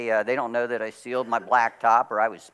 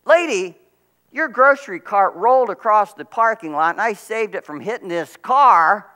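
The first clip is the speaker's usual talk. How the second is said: raised, higher voice projecting to onlookers; said aloud for all to hear; 7 semitones higher, 6 dB louder than usual. Retold or imitated. Pastor calls out to the woman so onlookers hear. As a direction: announce it so the whole parking lot hears. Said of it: "I said aloud, so they hear".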